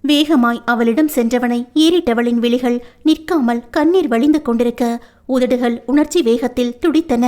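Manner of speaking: ordinary delivery, nothing marked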